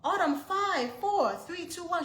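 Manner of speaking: very high, loud screamed countdown; screaming countdown. Retold or imitated. Frantic mother screaming a countdown at her hiding daughter, very high and loud. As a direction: scream each number, getting desperate. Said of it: "I'm screaming at this point".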